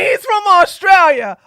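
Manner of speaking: loud, high, fed up; exasperated shout. Real, not acted. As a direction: yell it in disbelief. Exasperated outburst about Myron.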